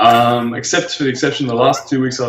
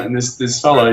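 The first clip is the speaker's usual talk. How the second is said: normal talking voice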